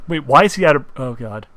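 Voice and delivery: ordinary delivery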